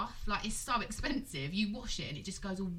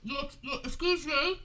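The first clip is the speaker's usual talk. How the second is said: snooty woman; 5 semitones higher than usual. Retold or imitated. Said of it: "She went".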